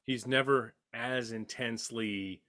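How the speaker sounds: normal talking voice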